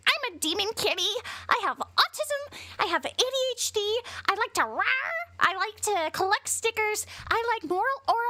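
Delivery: put-on very high, childish singsong voice; mock a quirky kid, high and cutesy. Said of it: "see him be like".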